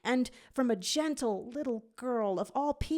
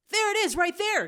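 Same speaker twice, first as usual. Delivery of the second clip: much higher, louder, eager; high girl voice; 12 semitones higher, 6 dB louder, faster than usual. A narrator's reading. Gadget spots the portal, high and eager. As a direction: point it out, bright and high. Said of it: "Gadget points".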